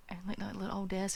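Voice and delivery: quick hushed whisper. Real, not acted. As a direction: point it out under your breath. Hushed wonder in kid's room.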